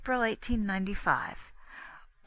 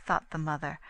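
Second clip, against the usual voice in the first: ordinary delivery, nothing marked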